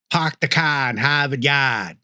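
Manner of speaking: dropped-R New England accent; non-rhotic New England accent. Shown as a demonstration. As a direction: say it the Boston-Maine way, no Rs. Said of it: "that vernacular is even more pronounced".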